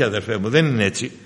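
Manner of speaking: usual speaking voice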